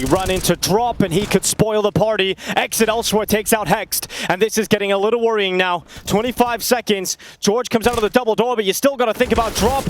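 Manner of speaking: rising hype over a clutch round; excited. Real, not acted. Clutch round, caster building hype.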